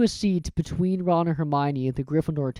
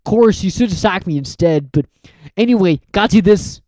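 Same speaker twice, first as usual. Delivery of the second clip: louder, gruff giant; Hagrid's voice; 4 semitones higher, 7 dB louder than usual. Acted.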